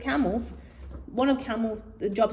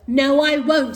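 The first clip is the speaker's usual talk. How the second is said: stubborn camel; 4 semitones higher, 7 dB louder than usual. Acted. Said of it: "said Calamity".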